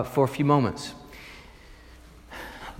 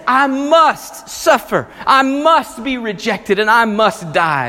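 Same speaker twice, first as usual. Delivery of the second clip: higher, louder, slow on the first line, pressing each must; solemn insistence; 6 semitones higher, 5 dB louder, slower than usual. Retold or imitated. Jesus telling his disciples he must die: solemn emphatic insistence. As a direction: lean hard on every must.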